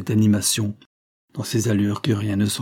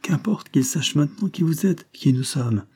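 higher, vivacious exclamation; lively outburst; 6 semitones higher than usual. A narrator's reading. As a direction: burst in eagerly, pitch up. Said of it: "s'écria tout à coup Vibert avec vivacité". (avec vivacité)

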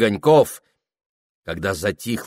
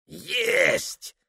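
put-on old soldier voice; 8 semitones higher, 6 dB quieter than usual. Deaf old gunner answers.

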